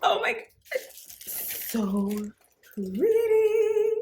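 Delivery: very high, squealed delight; high-pitched unboxing squeal; gushing. Real, not acted. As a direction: swoon over the boots. Unboxing gushing over boots.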